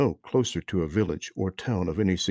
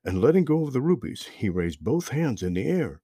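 plain reading voice

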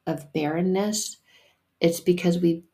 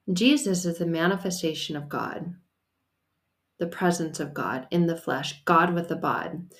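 normal talking voice

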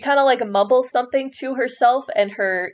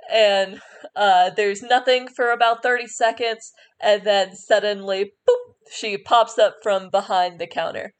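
normal talking voice

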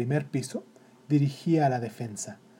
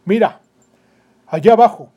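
loud, higher pointing command; commanding captain; 6 semitones higher, 13 dB louder than usual. Acted. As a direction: direct the boy's gaze loudly. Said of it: "dijo el capitán".

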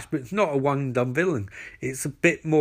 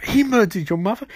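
raised-pitch incredulous question; hammed-up disbelief, voice pitched up; mock shocked echo; 6 semitones higher than usual. [name] repeats Barry's revelation back, mock shock.

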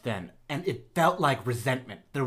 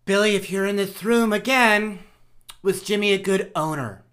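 raised, louder voice calling a spirit; séance medium; 5 semitones higher, 5 dB louder than usual. Pretend. Medium calls the spirit again.

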